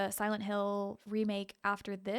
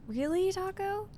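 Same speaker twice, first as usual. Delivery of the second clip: incredulous; 10 semitones higher, 5 dB louder, slower than usual. Real, not acted. Reacting to chat comment.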